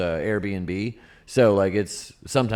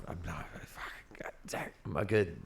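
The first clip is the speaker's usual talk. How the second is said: whispering; 3 semitones lower, 13 dB quieter, slower than usual. Real, not acted. Aside to partner mid-recording.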